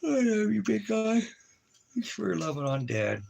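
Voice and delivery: very high falsetto, easing down. Real, not acted. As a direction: coo high at a beloved dog. Gushing love at his dog.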